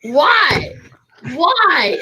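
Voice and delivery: loud, rising indignant whine; exasperated loud why; whiny protest. Real, not acted. Protesting being made to greet.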